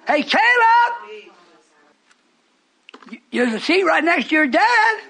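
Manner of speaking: high, loud holler to someone across a room; acted-out shout across the church; calling out. Voices father calling out to Caleb.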